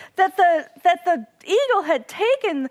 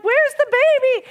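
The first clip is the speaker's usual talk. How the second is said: higher, louder anxious question; worried cry; 9 semitones higher, 5 dB louder than usual. Retold or imitated. Watching from across the lake, a worried louder cry. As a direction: call out fearing for the chick. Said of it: "we're like".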